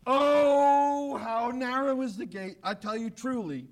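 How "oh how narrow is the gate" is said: loud, ringing Oh, then the rest; emphatic Oh. Shown as a demonstration. Preacher to congregation, stressing the exclamation Oh. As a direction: throw the Oh out as an exclamation. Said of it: "just with a little word O there".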